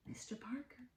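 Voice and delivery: hushed whisper of a name. A narrator's reading. Teacher whispering the villain's name to children. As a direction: breathe it secretly to the kids.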